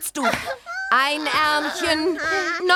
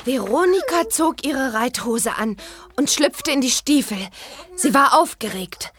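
lower, storybook reading voice; reading aloud; 6 semitones lower than usual. Acted. Bibi reading her pony book aloud, storybook manner. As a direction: read the pony book to yourself with relish.

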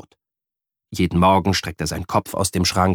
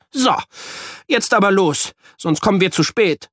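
put-on determined boy; 10 semitones higher than usual. A narrator's reading. Jan braces himself.

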